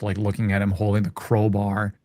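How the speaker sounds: ordinary talk, nothing marked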